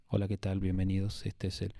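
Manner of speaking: low, drowsy podcast welcome; sleepy host. Retold or imitated. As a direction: sound half asleep at the mic. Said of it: "el locutor está dormido". (locutor dormido, grave y apagado)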